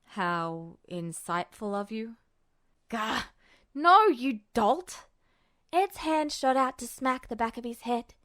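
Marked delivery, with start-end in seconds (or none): 0.0-2.2 dry sarcasm. Acted. Roy's dry sarcastic reply. 2.9-5.1 exasperated, louder, slower "No, you dolt!"; irritated outburst. Acted. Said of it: "irritation flashing in his eyes".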